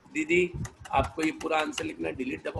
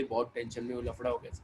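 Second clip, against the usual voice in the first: put-on low, much softer worried girlfriend; worried quiet voice; 5 semitones lower, 7 dB quieter than usual. Tamanna confides her worry to her lover, low and soft. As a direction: murmur the trouble down to him.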